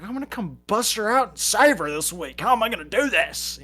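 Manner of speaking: high, worked-up self-imitation; frantic resolve. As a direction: re-enact a frantic rescue plan. Re-enacts his frantic rescue plan.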